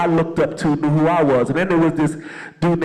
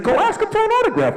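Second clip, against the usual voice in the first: high, fast mock voice of the manager; playful imitation of the manager; manager's urging; faster than usual. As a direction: squeak it out quick like the manager. Manager's line retold.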